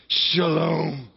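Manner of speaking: Shalom like cool water rushing over you; refreshed shalom. Shown as a demonstration. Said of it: "like you really wanna be refreshed".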